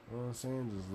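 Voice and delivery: ordinary talk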